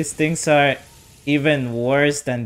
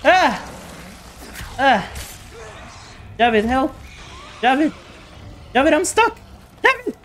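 high, loud panicked screams for help; being chased; 4 dB louder than usual. Real, not acted. Stuck, monster attacking.

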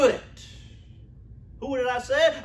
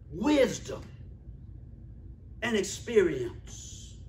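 drawn-out, softer repeat; slow emphasis; 4 semitones lower, 10 dB quieter than usual. Shown as a demonstration. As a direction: let wisdom and experience sink in slowly.

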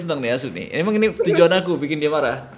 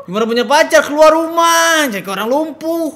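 mocking shout; 10 semitones higher, 8 dB louder than usual. Mocking loud roast.

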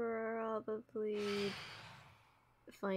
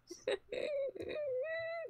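laughing cry. Real, not acted. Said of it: "I'm gonna cry".

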